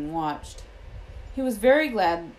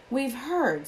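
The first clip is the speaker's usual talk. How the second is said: grumpy, higher-pitched retort; 7 semitones higher than usual. A narrator's reading. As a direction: cut in with mild grumpiness.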